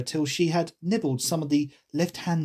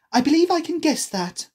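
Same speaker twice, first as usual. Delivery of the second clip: put-on high, louder girl voice; raised, eager heroine; 13 semitones higher, 6 dB louder than usual. A narrator's reading. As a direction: pipe up clearly. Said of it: "she added out loud".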